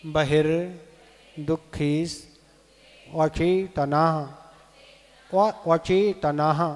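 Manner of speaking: each word said separately, measured and deliberate; pronunciation demo. Shown as a demonstration. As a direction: sound out the Sanskrit for pupils to repeat. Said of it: "एक बार और". (एक-एक शब्द का स्पष्ट उच्चारण)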